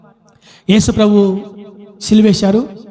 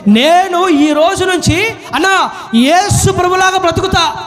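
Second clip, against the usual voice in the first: high, ringing declaration of resolve; rousing vow; 8 semitones higher than usual. Shown as a demonstration. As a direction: proclaim it like a pledge, drawing out the end. Models a vow for congregation. (దృఢమైన తీర్మానంగా ఎలుగెత్తి)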